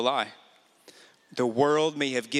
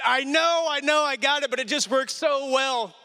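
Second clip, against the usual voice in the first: defensive; 12 semitones higher than usual. Retold or imitated. Retold defensive reply to wife.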